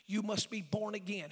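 ordinary talk, nothing marked

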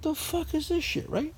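high, slow, quiet disbelief; baffled. Retold or imitated. Driver sees the huge water building, high slow disbelief. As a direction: stare at it, totally lost. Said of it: "So I'm like".

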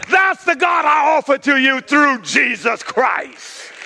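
full-force preaching. Acted. Pastor preaching to the church, calling out at full force. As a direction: preach it hard to the congregation.

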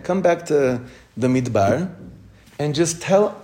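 ordinary talk, nothing marked

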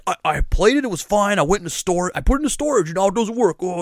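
high-pitched mock tantrum; imitating an upset customer, pitch climbing; imitated fit. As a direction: whine it out like a customer having a fit. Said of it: "he's, like, having a fit".

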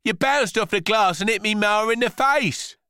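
put-on high, loud, indignant kid; protesting boy. Boy reminds rhubarb it hit his ma, protesting.

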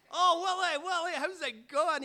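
laughing, hammed-up reply to the drunk; dad's greeting. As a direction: play dad as a cheery old mate. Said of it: "He's like".

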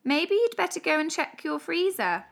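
plain reading voice

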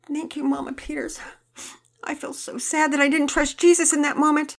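put-on a crying girl confessing to her mother; tearful. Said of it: "Penelope began to cry again".

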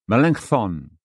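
normal talking voice

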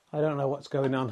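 ordinary talk, nothing marked

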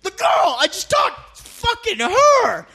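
high, slowed, strained yell; panicking that she's right there; high frantic yell answering a confused friend; frantic excited shout. Retold or imitated. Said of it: "What are you talking about?".

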